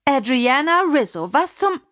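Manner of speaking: high, indignant call, cut off; scolding turning shocked. Acted. Mother Lina running from the bedroom, indignant then shocked. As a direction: start scolding, rise sharply and break off.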